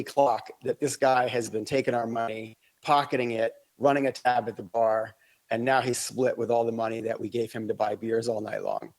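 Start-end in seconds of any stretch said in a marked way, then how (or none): none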